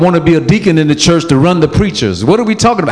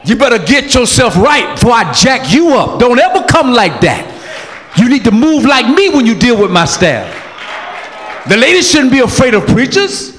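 fired-up shout; 6 semitones higher than usual. Real, not acted. Preacher shouting at men who scare the staff. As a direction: thunder the warning at the men. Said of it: "Let me calm down".

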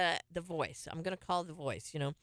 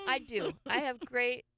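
ordinary talk, nothing marked

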